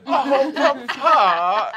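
high, slow laughing cry; drawn-out laughing "oh"; laughing delight. Acted.

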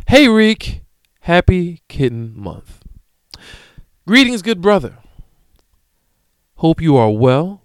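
mock fan greeting voice; reading the email. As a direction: read the fan mail aloud, playfully. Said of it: "It comes from Kinks with Kiki".

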